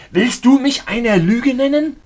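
high, loud, furious challenge; enraged shout. A narrator's reading. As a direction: yell it as you grab him. Enraged while grabbing the man.

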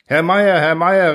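loud, high call after someone. A narrator's reading. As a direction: call the name down the stairwell. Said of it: "rief ich". (laut hinterhergerufen)

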